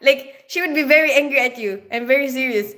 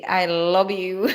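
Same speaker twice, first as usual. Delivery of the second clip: put-on Mexican accent; 5 semitones lower, slower than usual. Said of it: "My Mexican ex used to say".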